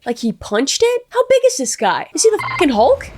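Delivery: high-pitched, baffled disbelief; incredulous. Real, not acted. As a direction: react in disbelief, voice leaping high. Astonished reaction to the smashed door.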